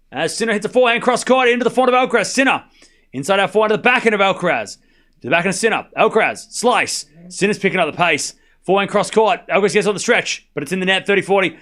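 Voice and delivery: fast tennis commentary; live play-by-play. Real, not acted. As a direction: call the rally shot by shot, quickly.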